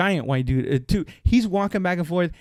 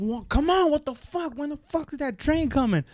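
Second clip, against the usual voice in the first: high-pitched mock yell, kept soft; high, exasperated complaint, held-back volume; retold impatient yelling; 9 semitones higher, 5 dB quieter than usual. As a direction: imitate an agitated man yelling without the volume. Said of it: "he keeps yelling it".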